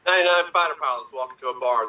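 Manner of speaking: ordinary talk, nothing marked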